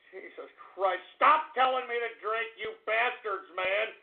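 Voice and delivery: low, quiet exasperated grumble; a tired, defeated grumble at the chat; weary muttering. Acted. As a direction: mutter it, worn out. Deflated mutter.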